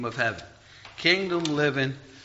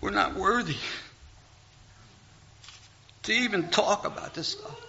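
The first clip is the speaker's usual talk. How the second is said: halting, higher-pitched, overcome with emotion; long pauses as emotion catches the voice; choked up; 6 semitones higher than usual. Real, not acted. Preacher humbled before his congregation at being unworthy, choked up. Overcome after retelling crying on beach.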